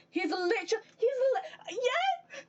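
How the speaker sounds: high, strained furious yelp; enraged squeal. Real, not acted. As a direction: shriek it out in pure outrage. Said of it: "I got so enraged".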